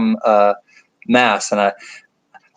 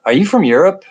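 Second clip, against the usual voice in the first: quoted question; 7 semitones higher, faster than usual. Retold or imitated. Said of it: "I get a lot of".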